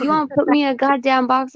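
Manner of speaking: high, fast challenge; retold indignant comeback. Acting out her angry comeback in quarrel.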